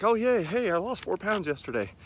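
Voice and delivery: high, bright mock cheer; giddy excitement. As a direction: gush like a happy dieter. Mocks dieters celebrating weight loss.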